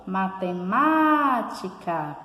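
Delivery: slow "matemática", swelling on the third syllable; calling-out stress. Shown as a demonstration. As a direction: call out "matemática", holding the "má". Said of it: "quando a gente pronuncia".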